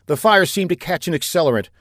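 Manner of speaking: ordinary delivery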